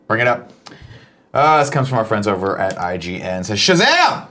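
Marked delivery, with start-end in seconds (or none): 3.6-4.3 high, punched superhero exclamation; sudden high-pitched burst. Shown as a demonstration. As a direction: shout it out like a magic word. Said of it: "say it with the exclamation".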